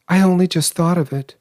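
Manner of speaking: high, breathless hysteria; hysterical. A narrator's reading. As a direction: blurt it out in frantic disbelief. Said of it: "she said hysterically".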